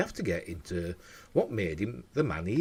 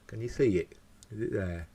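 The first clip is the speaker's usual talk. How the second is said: normal talking voice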